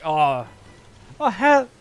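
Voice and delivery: chirpy American greeting. Retold or imitated. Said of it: "it's like".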